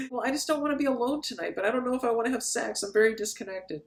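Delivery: high, soft mock-woeful voice; reluctant woman. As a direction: play her detached and softly high-pitched. Acted woman, reluctant date.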